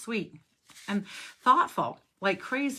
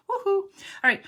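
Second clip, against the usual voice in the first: cheer; 13 semitones higher, 5 dB louder than usual. Real, not acted. Stamp finally stays on.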